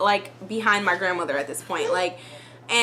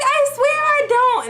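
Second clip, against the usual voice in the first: much higher, a bit louder pleading; pleading protest; 5 dB louder than usual. Retold or imitated. Teen swears to her grandma in a high pleading voice. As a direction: beg to be believed.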